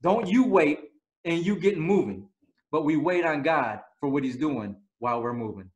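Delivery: usual speaking voice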